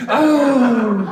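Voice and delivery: high, loud gasp for the audience to copy; loud startled oh with a laugh; shocked gasp. Shown as a demonstration. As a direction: model the crowd's gasp, laughing a little. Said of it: "Try that, ready?".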